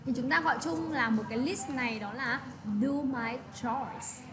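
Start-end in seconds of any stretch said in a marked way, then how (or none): 2.8-4.2 very slow, careful "do my chores"; slow teacher demo of "do my chores"; slow model reading. Shown as a demonstration. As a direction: drag out "do my chores" so students can copy it.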